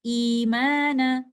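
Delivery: Imana recited slowly and loud, ee held two counts; recited form. Shown as a demonstration. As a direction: chant Imana with a long ya mad. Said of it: "we recite this word like this".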